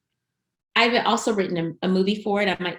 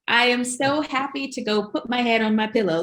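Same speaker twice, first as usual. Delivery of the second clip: higher-pitched, happily declining; mock refusal; 6 semitones higher than usual. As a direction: play-act turning down a night out. Imitating own reluctant group reply.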